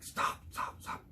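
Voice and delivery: urgent hushed whisper; retold hunter's whisper. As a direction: whisper a quick halt to the boys behind. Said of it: "And I whisper into you".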